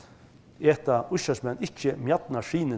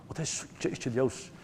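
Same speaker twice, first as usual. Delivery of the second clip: usual speaking voice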